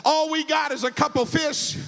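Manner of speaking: full-force preaching. Acted. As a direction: rouse the church to praise. Preacher retells the story, building to the refrain.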